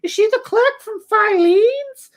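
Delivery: very high mock-mom demand; slow, exasperated falsetto question; detonating mom. Mom interrogating her son about Charlene, exasperated falsetto. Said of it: "then she absolutely detonates".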